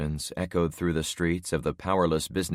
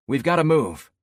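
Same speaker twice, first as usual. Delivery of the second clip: urgent shout; 10 semitones higher, 7 dB louder than usual. Acted. Urgent order during plane crash.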